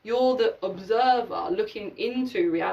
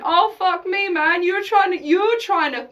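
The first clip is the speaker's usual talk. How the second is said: higher and louder, incredulous outburst; mock exasperated, pitched-up complaint; exasperated disbelief; 8 semitones higher, 6 dB louder than usual. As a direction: react like someone's feeding you nonsense. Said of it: "you'd be looking at me going".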